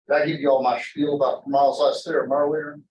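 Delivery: usual speaking voice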